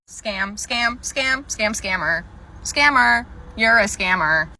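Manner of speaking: repeated taunt building to a loud accusation; mock-outraged heckle, ending loud; heckling accusation. Acted customer heckling, accusing employee.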